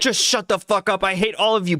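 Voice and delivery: high put-on voice of a fed-up streamer; mock exasperated outburst. Co-host playing streamer berating his chat, mock outburst.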